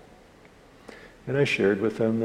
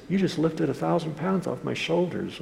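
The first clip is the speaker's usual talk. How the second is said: higher, lightly elated voice; mildly elated, pitched up; retold relief; 7 semitones higher than usual. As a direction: marvel at the relief, imitating him. Retold relief of forgiven man.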